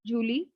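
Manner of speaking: Julie with an English J sound at the start; English-style Julie. Shown as a demonstration. Teacher showing learners the wrong July, English J in Julie.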